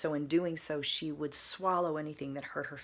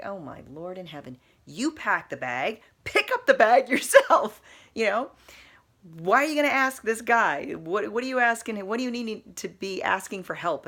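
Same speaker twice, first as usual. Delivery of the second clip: much louder and higher, laughing in disbelief; exasperated inner rant; 6 semitones higher, 11 dB louder than usual. Retold or imitated. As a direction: rant at them, getting louder. Her exasperated inner judgment, retold.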